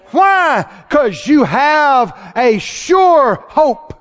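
high, emphatic pulpit delivery; fervent preaching. Real, not acted. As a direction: preach it hard, stretching the key words. Preaches at full force.

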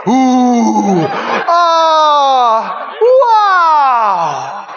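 hammed-up, drawn-out awe; oohing and aahing. Preacher acting out the saints' reaction, oohing in exaggerated awe. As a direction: stretch each sound in falling wonder. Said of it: "we'll go".

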